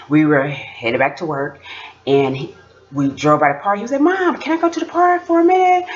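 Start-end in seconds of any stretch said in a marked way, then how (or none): 4.0-5.9 put-on high-pitched kid voice; high little-kid request; imitated pleading child. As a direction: imitate a son begging mom in a high voice. Mother voices her young son asking.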